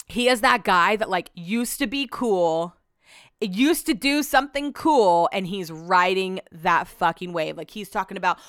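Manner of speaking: ordinary delivery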